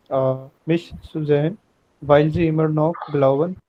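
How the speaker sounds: ordinary talk, nothing marked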